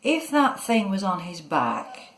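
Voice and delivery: usual speaking voice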